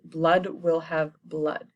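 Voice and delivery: slow, foreboding delivery; ominous line. Retold or imitated. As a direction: let each word of Blood will have blood land slowly. Ominous restated Macbeth line.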